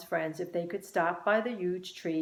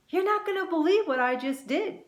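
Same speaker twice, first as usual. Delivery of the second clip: excited; 11 semitones higher, 4 dB louder than usual. A narrator's reading. Excited news to Glowwings.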